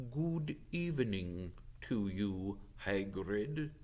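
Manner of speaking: a hint of a grave old centaur; deep sorrowful centaur. Ronan greets Hagrid, slow and solemn. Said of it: "He had a deep, sorrowful voice".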